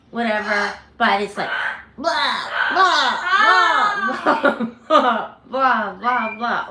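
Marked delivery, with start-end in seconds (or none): 2.0-6.7 bird noise as repeated blahs; lightly imitated, insistent bird calls; imitated repetitive squawking. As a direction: mimic a bird calling on and on. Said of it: "over and over and over and over".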